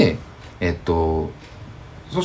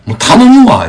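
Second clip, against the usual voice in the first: high, loud fed-up outburst; retold angry outburst at a careless nurse, high and loud; snapping in annoyance; 13 semitones higher, 12 dB louder, slower than usual. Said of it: "ちょっとキレてもって".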